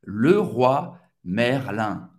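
Le Roi Merlin slow and low, ler-wa mer-lan; French pronunciation. Shown as a demonstration. Teacher models the full name slowly. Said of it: "Agora é sua vez de treinar".

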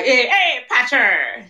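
a hint of a jokey leprechaun greeting; Jamaican leprechaun. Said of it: "That was my leprechaun voice".

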